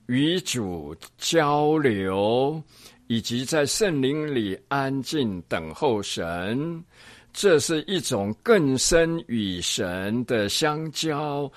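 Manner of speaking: slow, drawn-out reading; reading aloud. Acted. Teacher reading a pastor's passage on the Holy Spirit, slow deliberate reading. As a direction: read each phrase deliberately, stretching the words.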